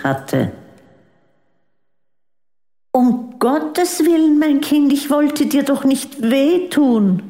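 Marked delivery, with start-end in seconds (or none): 2.9-7.2 shocked mother. Acted. Mother shocked by daughter's outburst.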